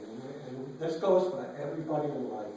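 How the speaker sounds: normal talking voice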